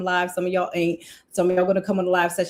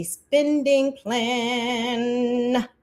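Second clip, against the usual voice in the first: "spending plan" stretched out slowly; drawn-out emphasis; 3 semitones higher, much slower than usual. Real, not acted. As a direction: draw out each word of "spending plan". Teasingly stretches the corrected term.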